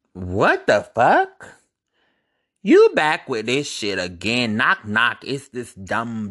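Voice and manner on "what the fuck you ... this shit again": slow, drawn-out, louder what the fuck; slow incredulous outburst; stunned disbelief. Real, not acted. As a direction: stretch it out in disbelief. Shocked by his message.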